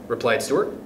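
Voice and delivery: usual speaking voice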